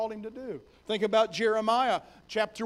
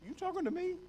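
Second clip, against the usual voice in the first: disbelieving; 10 semitones higher, 7 dB quieter, faster than usual. Acted. Gideon doubts angel's words.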